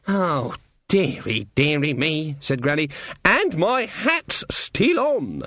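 high, slow sarcastic crone; mocking old witch voice. Granny voiced.

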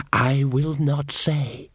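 slow, otherworldly elf, each word dragged out; cold elf voice. A narrator's reading. Captured elf refuses Magrat, slow and cold. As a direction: refuse to beg, slowly and coldly.

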